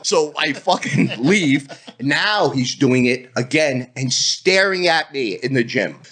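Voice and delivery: ordinary delivery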